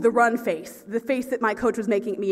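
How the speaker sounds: ordinary talk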